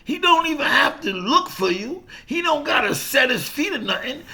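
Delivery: half-yelled coaching, fed up with him charging straight in; exasperated manager. Retold or imitated. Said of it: "He said".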